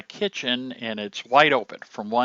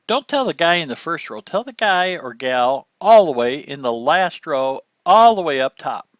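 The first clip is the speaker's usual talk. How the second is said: louder, carrying to the back row; projecting voice; 3 semitones higher, 8 dB louder than usual. Shown as a demonstration. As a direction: reach the person in the last row, up top. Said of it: "you have to speak loud and clear".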